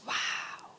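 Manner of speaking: hushed, breathy-quiet awe; awed whisper. Retold or imitated. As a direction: drop to a near-whisper, awestruck. Acted awe at the pyramids.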